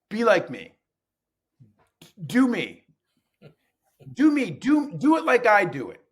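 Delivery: hammed-up teacher ordering students to imitate him; mock bossy teacher. Teacher mocking the old way, loud mock command. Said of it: "I don't say".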